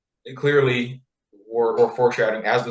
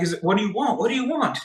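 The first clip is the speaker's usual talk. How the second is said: high, hammed-up pleading questions; eager king; 9 semitones higher, faster than usual. King begging his queen to name her wish, mock-eager. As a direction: imitate a king bursting to grant a wish.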